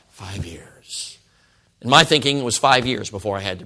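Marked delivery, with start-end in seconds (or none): 0.1-1.2 low, whispery private sum; hushed reckoning. Retold or imitated. Teen counting years before he must preach, hushed. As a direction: breathe it quietly to yourself.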